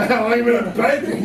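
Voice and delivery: hammed-up botched attempt at interest; badly phrased question. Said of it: "It didn't come out pretty well".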